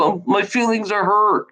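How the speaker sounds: hammed-up hurt feelings; sarcastic mock hurt. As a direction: pretend to be wounded.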